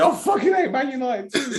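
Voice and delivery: high-pitched mock complaint; imitating Kojo. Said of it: "Kojo's sitting there going".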